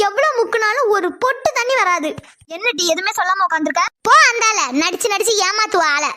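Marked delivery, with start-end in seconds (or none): 4.1-4.6 loud, sudden angry dismissal; yelling. Acted. Mother yells at crying daughter to go away. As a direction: yell it out to send her away. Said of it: "என்ன டீ அலறிட்டு இருக்க?".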